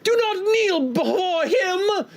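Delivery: high, slow theatrical defiance; melodramatic heroine. Acted. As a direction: ring it out like a stage heroine. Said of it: "You see the woman who was going".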